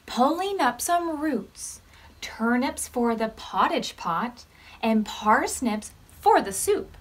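gentle storybook read-aloud; reading to children. Acted. Teacher reading a counting story to the class, gently. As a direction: read it like story time for little ones.

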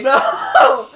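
shouted no through laughter; loud protest. Real, not acted. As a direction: yell no, half laughing.

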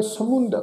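ਸਮੁੰਦ as sa-mund, short nasal u; careful demonstration of ਸਮੁੰਦ as samund. Shown as a demonstration. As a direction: end ਸਮੁੰਦ on -und, no final r. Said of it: "ਉਚਾਰਨ ਕਰਾਂਗੇ".

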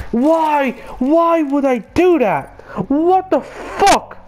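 high-pitched, drawn-out disbelieving cry; frustrated gamer outburst, high and stretched; exasperated yell. Real, not acted. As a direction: shriek it slowly at the screen in disbelief. Raging at his own mistake.

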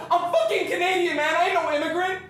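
high, loud defiant protest; indignant shout. Retold or imitated. Man answers those shouting at him, indignant shout. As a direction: yell back at the tormentors. Retelling his angry protest.

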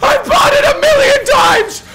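high, loud, laughing shouts; screaming with joy. Real, not acted. Streamer to chat after a rare red, screaming with joy. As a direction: scream it like you called the jackpot.

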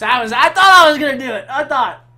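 loud disappointed cry; frustrated shout. Real, not acted. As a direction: groan it out in frustration. Frustrated after failing nuke.

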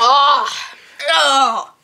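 sharp, loud cry of disgust. Real, not acted. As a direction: cry out at the awful taste. Reaction to tasting gross bean.

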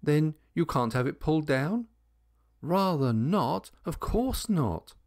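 high-pitched indignant protest. A narrator's reading. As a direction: object as if affronted. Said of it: "exclaimed several indignant voices at once".

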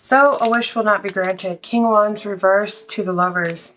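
ordinary talk

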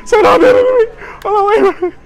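high, loud mock sobbing beg; hammed-up wail for mercy; fake crying plea. Begging the VR guard not to call police, a hammed-up sob.

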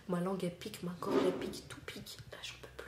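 fake fading whisper. Faked spice pain before the reveal.

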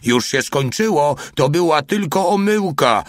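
high call, climbing as it goes; shouting. A narrator's reading. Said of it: "krzyknął mały". (wysokie, coraz wyższe wołanie)

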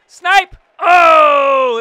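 loud triumphant yell; big goal shout; excited shout. Real, not acted. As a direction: roar it out at the shot. Scoring a goal.

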